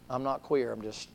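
usual speaking voice

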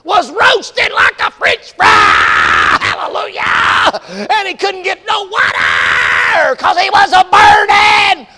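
high, loud, slow shouting sermon; fire-and-brimstone preacher shouting about the rich man in hell; hellfire preaching. Pretend. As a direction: thunder it from the pulpit. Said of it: "I'm laying the verses out".

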